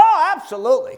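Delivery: bursts out high and loud on Oh; imitating an eager old pastor's cry; emphatic exclamation. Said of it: "he would have spoken right out".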